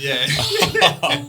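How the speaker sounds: real laugh on a loud yeah. Real, not acted. Guest laughing loudly after telling the purge joke. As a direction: burst out laughing on the word. Punchline of funny purge story.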